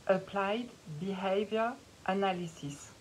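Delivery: the English term said in a playful English accent. Shown as a demonstration. Coach showing off her English accent on the name. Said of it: "Vous avez vu ce super accent anglais".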